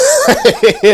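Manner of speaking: sudden laugh up high; real laugh. Real, not acted. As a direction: burst out laughing. Laughing at his own joke.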